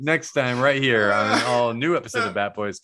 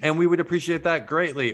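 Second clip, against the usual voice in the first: normal talking voice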